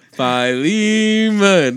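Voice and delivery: Philemon stretched out high and loud as fye-LEEEE-mun; exaggerated pronunciation. Joking about Bible pronunciations, Philemon comically drawn out on LEE. Comic repeat of the disputed name.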